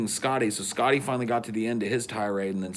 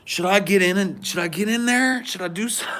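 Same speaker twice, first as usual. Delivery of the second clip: flustered; 9 semitones higher, 3 dB louder than usual. Retold or imitated. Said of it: "so I'm just like".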